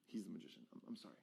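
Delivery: low, very soft apology; reluctant admission. Shown as a demonstration. Magician concedes and apologizes to his assistant, reluctantly. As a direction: cave in, almost inaudibly. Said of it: "almost like I don't wanna say it".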